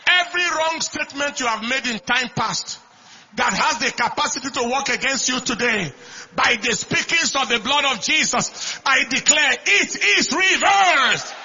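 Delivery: high, loud declaration, pushed hard; shouted pulpit proclamation; full-force preaching. Real, not acted. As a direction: preach it as a forceful decree over the congregation.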